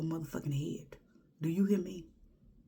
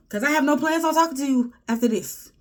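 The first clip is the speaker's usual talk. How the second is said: much higher and louder, pushing on talking; loud declaration; 10 semitones higher, 9 dB louder than usual. Acted. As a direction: belt it out like a final ruling. Vowing not to talk to him.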